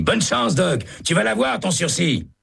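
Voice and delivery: very high, eager cellmate voice; a convict calling good luck, high and eager; high prisoner voice. A narrator's reading. Hennessy calling from his cell.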